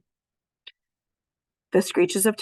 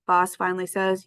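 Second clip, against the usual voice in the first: usual speaking voice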